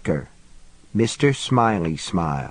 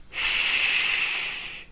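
breathy hushing whisper; shushing whisper; 8 dB quieter than usual. A narrator's reading. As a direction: hush him with a soft shh. Cop hushes pleading driver.

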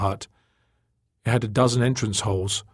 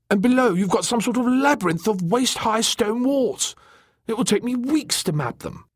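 high, enthusiastic explainer; excited; 12 semitones higher than usual. Acted. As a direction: rattle off the discovery in a high, eager voice. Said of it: "he said excitedly".

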